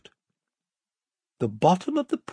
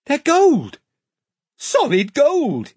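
higher, louder burst of alarm; excited; 12 semitones higher, 5 dB louder, slower than usual. Acted. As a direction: blurt solid gold out, high and urgent. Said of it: "interrupted Edmund".

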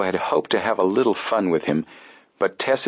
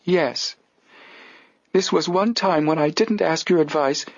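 put-on high, earnest old lady voice; elderly lady voice; 9 semitones higher than usual. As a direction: plead it like an old spinster. Adelia voiced by narrator.

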